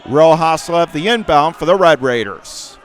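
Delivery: normal talking voice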